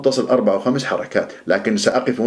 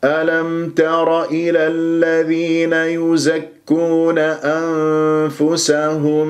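slow, measured tajweed recitation; Quran recitation; 3 semitones higher, much slower than usual. Shown as a demonstration. Teacher reciting the next verse, slow measured recitation. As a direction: hold the long vowels on a steady note.

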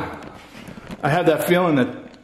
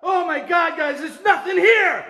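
shaken disbelief; 13 semitones higher than usual. Real, not acted. After the scare.

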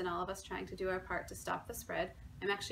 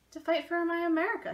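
determined; 8 semitones higher, 7 dB louder than usual. A narrator's reading. Baker answers wife, resolved.

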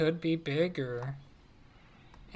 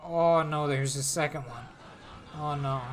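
usual speaking voice

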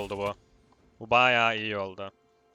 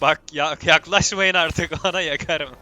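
laughing; 5 semitones higher, 8 dB louder, slower than usual. Real, not acted. Laughing threat at enemies.

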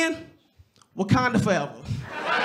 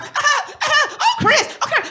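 much higher, giggling coos; giggly flirty wife; 13 semitones higher, 3 dB louder than usual. Retold or imitated. Wife flirts with Chris Rock, high giggling coo. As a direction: coo like a smitten fan.

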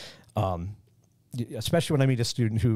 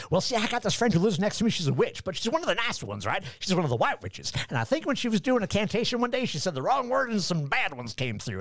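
high-pitched, gossipy Southern caricature; mock Southern accent; 9 semitones higher than usual. Man explaining his witch neighbor to lecturer, mock Southern accent. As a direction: ramble on in a put-on Southern accent. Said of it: "I go right to that accent".

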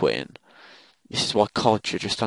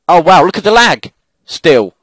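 loud, high-pitched exasperation; outraged shout; 9 semitones higher, 11 dB louder than usual. Real, not acted. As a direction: yell in disbelief at the lag. Complaining about lag.

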